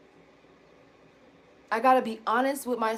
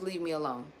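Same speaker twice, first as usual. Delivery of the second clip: put-on toddler's leave me alone; 7 semitones lower, 9 dB quieter than usual. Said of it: "my first sentence was".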